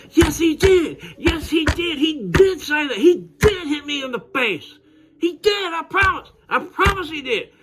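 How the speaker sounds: very high desperate whine, tattling; whiny kid pleading. Retold or imitated. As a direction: beg the teacher like a bratty child. Bully pleading to teacher.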